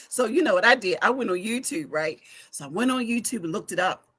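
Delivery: normal talking voice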